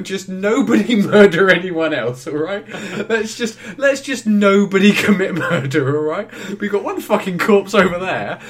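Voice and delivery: loud, high, hammed-up exasperation; exasperated mock plea. Passenger begging the others not to murder, mock-exasperated shout. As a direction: shout it like pleading with a carriage of suspects.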